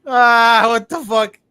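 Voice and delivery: startled, yelled what the fuck; shocked outburst. Real, not acted. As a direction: blurt it out in disbelief. Outburst at a strange move.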